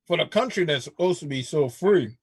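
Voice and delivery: ordinary talk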